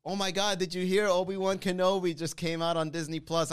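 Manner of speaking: ordinary talk, nothing marked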